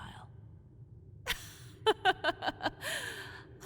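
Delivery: witchy cackle at the hunter's folly; high mocking cackle. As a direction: let out a high gleeful cackle.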